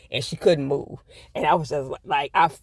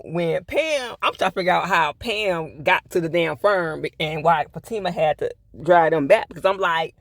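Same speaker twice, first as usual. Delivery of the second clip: usual speaking voice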